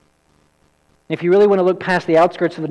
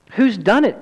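higher, urgent question as Susan; startled girl crying out, pitched up; alarmed cry; 6 semitones higher than usual. Acted. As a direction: ask it in sudden alarm. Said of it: "cried Susan".

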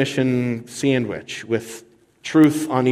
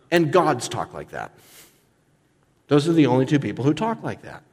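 usual speaking voice

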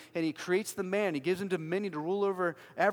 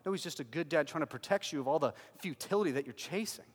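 normal talking voice

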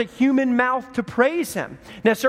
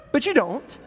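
normal talking voice

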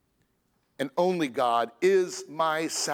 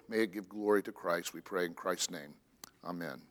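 prayer; 4 semitones lower than usual. Acted. Pastor praying over his congregation before the sermon, hushed.

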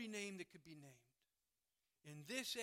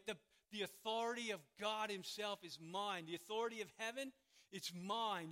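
loud, driving sermon delivery; forceful preaching; 2 semitones higher, 6 dB louder than usual. Real, not acted. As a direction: preach it with mounting insistence, hammering each claim. Preaches Christ's authority with force.

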